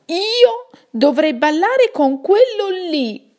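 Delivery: high indignant character whine; Fulmine outraged. Acted. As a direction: whine in disgust at the partner. Fulmine refuses to dance.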